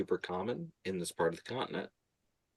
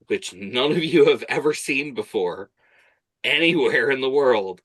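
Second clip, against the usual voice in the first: raised, emphatic; loud emphasis; 7 semitones higher, 10 dB louder than usual. Acted. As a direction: stress how rare this is. GM stressing the sight's rarity.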